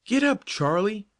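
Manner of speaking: high, urgent cry; cried out. Acted. Joe crying out to the balking horse: high urgent call. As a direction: urge the horse on in a panic. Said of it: "he cried".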